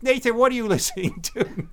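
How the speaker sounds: high, laughing mum voice; mother voice. Pretend. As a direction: nag like a puzzled mother. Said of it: "imagine Nathan's mother listening".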